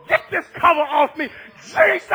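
loud, high desperate cry; screaming out. Acted. Blind Bartimaeus begging for Jesus, screaming out. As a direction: build to a shout on the name. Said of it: "screaming at the top".

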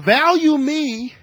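high, loud, slow "value me"; preacher's passionate demand; emphatic plea. Real, not acted. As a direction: stretch out each word to drive it home. Pleading to be valued.

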